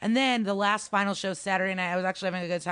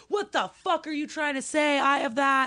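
mock angry heckler; 8 semitones higher, 4 dB louder than usual.

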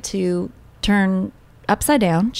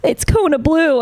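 husband crying; 8 semitones higher than usual. Retold or imitated. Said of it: "he started crying".

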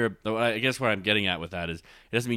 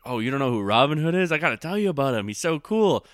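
higher, louder, eager and delighted; excited friend; 5 semitones higher, 5 dB louder than usual. Retold or imitated. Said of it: "As opposed to".

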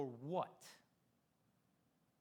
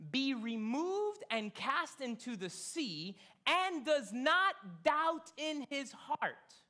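slow, louder preaching read; preaching scripture; 5 semitones higher, 5 dB louder, slower than usual. Acted. Pastor reading Mark to the congregation, slow forceful preaching. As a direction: declaim the verse slowly.

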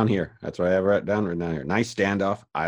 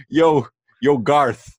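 calling Garth; 7 semitones higher, 6 dB louder, much slower than usual. Retold or imitated. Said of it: "they're like".

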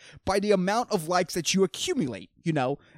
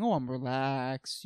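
mocking mellow people; 4 semitones lower, 7 dB quieter, slower than usual. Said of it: "people are like".

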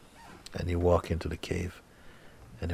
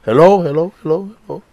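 much higher, much louder frightened calls; startled calling out; 12 semitones higher, 14 dB louder, faster than usual. Acted. The seeker calls into the dark cave, frightened. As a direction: call into the dark, scared.

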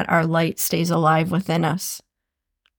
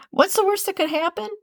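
upbeat; 10 semitones higher, faster than usual. Shown as a demonstration. Upbeat repeated motto.

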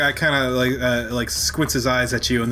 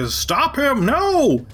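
yelling; 13 semitones higher, much slower than usual. Acted. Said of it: "you hear people yelling".

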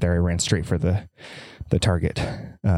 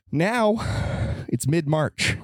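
dramatic now; 8 semitones higher, slower than usual. Real, not acted. Sudden dramatic turn.

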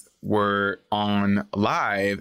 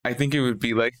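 evil chuckle; faster than usual. Shown as a demonstration. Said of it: "can I hear your actual, like, evil arch nemesis laugh?".

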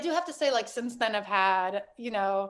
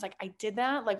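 ordinary talk, nothing marked

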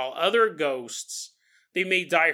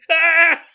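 mock electrocution yell; 11 semitones higher, 11 dB louder than usual. Listener electrocuted in story.

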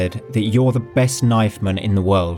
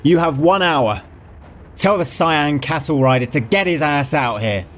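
gloomy shout; 7 semitones higher than usual. Acted. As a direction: bellow the challenge darkly. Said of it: "shouted with a lugubrious air".

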